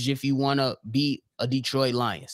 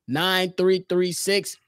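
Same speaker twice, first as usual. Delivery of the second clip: normal talking voice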